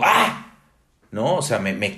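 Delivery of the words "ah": sharp high cry of pain; sudden burnt-hand yelp, higher and louder. Shown as a demonstration. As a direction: yelp as if scalded by hot coffee. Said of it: "Reacciono y digo".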